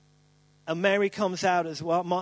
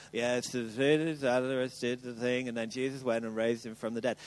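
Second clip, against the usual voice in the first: low, soft, dismissive read-through; bored reading; 8 semitones lower, 6 dB quieter than usual. Retold or imitated. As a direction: rattle it off like an old familiar story. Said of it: "We read it like".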